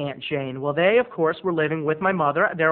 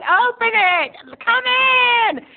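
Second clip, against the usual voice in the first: high, loud, drawn-out shriek; hollering grandma; 7 dB louder, slower than usual. Retold or imitated. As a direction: holler from bed like a batty old lady. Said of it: "hear my grandmother going".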